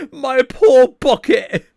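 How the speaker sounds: very high, slow, wailing put-on voice; imitating Greg. Player imitating Greg mourning his bucket, high wail. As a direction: squeal it like a heartbroken cartoon. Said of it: "Greg just saying".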